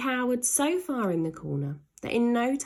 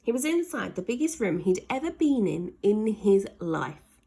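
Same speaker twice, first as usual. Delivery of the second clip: plain reading voice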